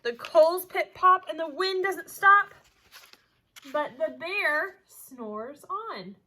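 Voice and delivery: lively storybook voice, big swings of pitch and loudness; animated read-aloud; reading to children. A narrator's reading. Teacher reads picture book aloud.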